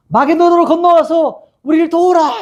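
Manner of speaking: high-pitched imitated plea for help; calling out. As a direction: call out as if beckoning from afar. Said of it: "이렇게 외치는 거예요". (도움을 청하며 높은 소리로 외치기)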